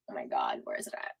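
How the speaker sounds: hushed aside to herself; whisper. Real, not acted. Searching the chat alone, whispering. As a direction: breathe it under your breath.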